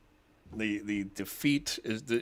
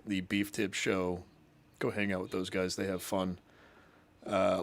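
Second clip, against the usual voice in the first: ordinary talk, nothing marked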